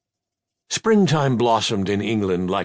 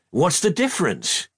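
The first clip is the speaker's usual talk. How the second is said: raised voice, higher pitch, near a shout; nearly shouting, raised; 7 semitones higher than usual. A narrator's reading. Boy snapping at his tutor, nearly shouting. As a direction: push it up toward a shout without letting go. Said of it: "he nearly shouted".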